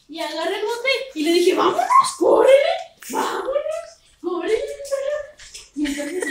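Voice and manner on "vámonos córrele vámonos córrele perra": loud, high call to the dogs. Retold or imitated. Rounding up the puppies, loud high calling. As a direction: call them to come running.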